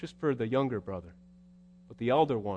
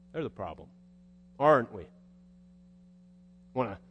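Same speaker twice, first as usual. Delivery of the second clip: normal talking voice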